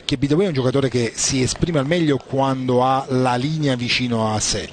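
ordinary delivery

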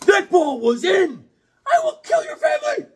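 high, furious shriek; hammed-up tennis tantrum, screaming up high; mock McEnroe yelling at the umpire; McEnroe rage. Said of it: "John McEnroe is always angry".

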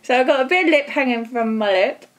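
fingers tugging at lips; laughing behind face mask; through a sheet face mask. Real, not acted. Seen 0: Sheet mask covers face, fingers adjusting mouth flap while speaking.

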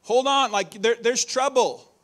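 high, loud, urgent alarm; alarmed warning. Retold or imitated. Preacher voicing Jesus' urgent warning to disciples, loud and high. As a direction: shout the danger like a real threat. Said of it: "this is the kind of warning that Jesus is giving".

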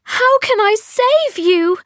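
high, tearful plea; sobbing plea. A narrator's reading. Astrid pleads with the prince through tears, high and sobbing. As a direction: cry out how can she save him. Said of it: "Astrid sobbed".

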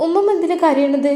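put-on high, childlike voice scolding grandma; little girl voice. Little granddaughter correcting grandma, high childlike voice. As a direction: play a small girl correcting her granny.

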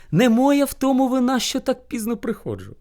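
high-pitched, apologetic little hare; pleading hare. A narrator's reading. Hare excuses lateness to bear, meek pleading.

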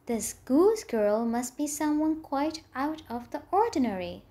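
usual speaking voice

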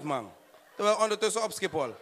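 usual speaking voice

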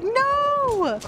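dismayed cry. Real, not acted. Her cop is losing the fight.

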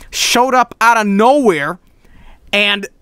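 usual speaking voice

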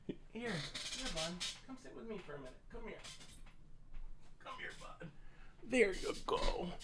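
soft real pet talk; soft puppy talk. Real, not acted. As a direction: soothe the sleepy dog on your lap. Cuddling puppy on lap.

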